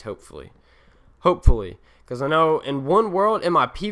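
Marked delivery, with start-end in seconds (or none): 0.0-0.5 muttered under the breath, lower and much softer; quiet afterthought. Real, not acted. Mutters then repeats louder. 1.2-1.8 HOPEFULLY said out loud, much louder than before; emphatic repeat. Real, not acted.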